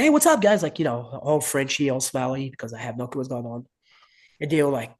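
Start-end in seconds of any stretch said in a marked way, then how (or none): 0.0-0.6 high, upbeat greeting, louder than usual; high-pitched breezy hail; retold greeting. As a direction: call out a cheery hello to the guys. Retold cheerful greeting to coaches.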